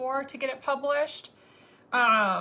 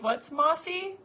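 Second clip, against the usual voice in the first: puzzled listener; 6 semitones higher, 3 dB louder, slower than usual. Retold or imitated. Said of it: "you're like".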